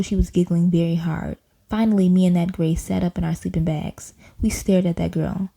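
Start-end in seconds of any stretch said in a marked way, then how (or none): none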